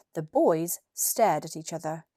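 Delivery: plain reading voice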